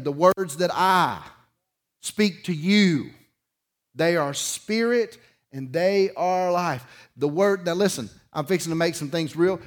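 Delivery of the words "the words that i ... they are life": slow, deliberate, each phrase spaced with pauses; slow emphatic reading. Shown as a demonstration. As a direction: lean on every word of the scripture. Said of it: "Listen to what he's saying".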